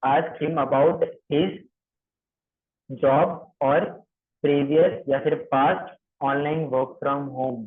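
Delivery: very slow, word by word; slow dictation. Shown as a demonstration. Trainer dictates the script for trainees, very slow. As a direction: spell it out slowly for note-taking. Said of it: "ये सारी चीजें लिखने के लिए".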